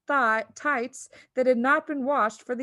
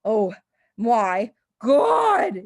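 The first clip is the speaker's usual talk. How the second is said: louder yell of dismay; 8 dB louder, much slower than usual. A narrator's reading. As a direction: yell at the mirror. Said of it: "yelled the father".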